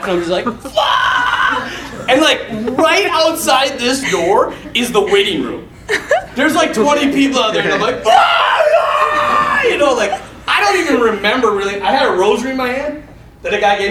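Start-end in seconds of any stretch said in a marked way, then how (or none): 0.4-2.1 high, strained mock scream; acted pain scream. Patient as four men set his arm, hammed-up scream. 8.1-9.6 very high, loud mock wailing; acted agony scream. Patient heard by the waiting room, piercing acted screams.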